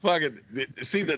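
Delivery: ordinary delivery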